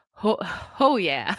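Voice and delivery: half-hearted cartoon catchphrase attempt; a weak stab at a cartoon catchphrase; Johnny Bravo. Sick host abandons a Johnny Bravo impression, half-hearted attempt. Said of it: "I can't do it".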